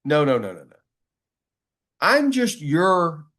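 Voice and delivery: ordinary talk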